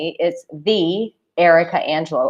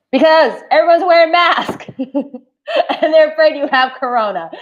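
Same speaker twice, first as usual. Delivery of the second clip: higher, louder, laughing through it; laughing punchline; 9 semitones higher, 5 dB louder than usual. Real, not acted. Telling viewers a joke and laughing at her own punchline. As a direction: crack up while delivering the punchline.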